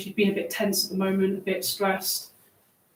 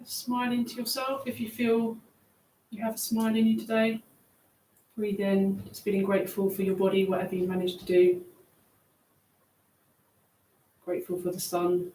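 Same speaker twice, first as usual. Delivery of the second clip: guided meditation; 5 dB quieter than usual. Acted. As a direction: ease them down gently. Leads a grounding meditation.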